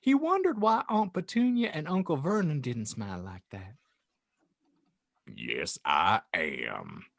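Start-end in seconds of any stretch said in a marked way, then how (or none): 5.3-7.1 much lower, slow gentle giant voice; kindly Hagrid. Acted. Hagrid answering the aunt, low and kind. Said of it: "Hagrid replied kindly".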